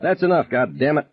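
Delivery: a lifted shout, not full force. A narrator's reading. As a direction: break up the fight, commanding. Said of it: "he was shouting".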